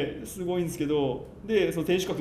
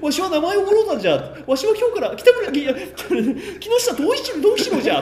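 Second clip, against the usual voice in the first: 高く張り上げた少年の声; 声を裏返して浮かれた少年を演じる; 甲高い少年の声真似; 5 dB louder than usual. Retold or imitated. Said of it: "っつって". (甲高い少年の声)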